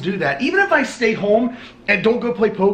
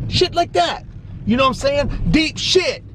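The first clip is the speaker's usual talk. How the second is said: hyped yelling; 7 semitones higher than usual. Real, not acted. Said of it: "Sorry, why am I yelling?".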